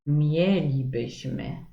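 mielibyśmy slowly, stress on MIE; mielibyśmy drawn out, first syllable MIE hit; mielibyśmy, stressed MIE-li-by-śmy; stress on fourth from end. Shown as a demonstration. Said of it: "jak je zaakcentujemy".

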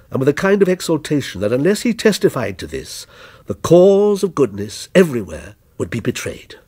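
ordinary delivery, nothing marked

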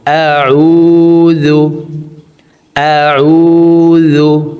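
"আউযু" with the vowel stretched one alif, slow chant; long vowel. Shown as a demonstration. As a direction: pull "আউযু" long and sung. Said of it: "এক আলিফ টেনে পড়ব".

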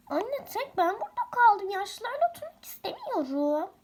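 high whining complaint to mom; sulky kid protesting to mother; whining about being left with the old folks; whiny child. Acted.

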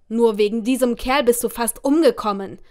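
loud, furious scolding; angry scolding. A narrator's reading. Angry father stands up and scolds his daughter, loud. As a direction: tear into her for almost dying because of him. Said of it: "schimpfte er weiter".

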